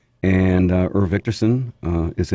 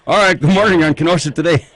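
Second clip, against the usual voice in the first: big on-air welcome, higher and louder; loud radio greeting; 8 semitones higher, 6 dB louder than usual. Acted. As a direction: greet the caller like a radio host. Radio host greets caller.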